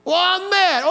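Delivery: high mock-childish whine of temper; pouting two-year-old. Preacher mimicking a grown man sulking, pouting toddler voice. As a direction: pout it out like a cross toddler. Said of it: "pout like a two-year-old".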